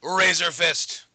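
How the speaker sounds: big drawn-out hype announcer shout; announcer show opener. Acted. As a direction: open the show like a ring announcer, stretching the key words.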